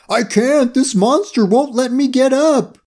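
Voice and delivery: high, distressed yell; yelling back. A narrator's reading. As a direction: yell back helplessly, pitched well up. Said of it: "Barney yelled back".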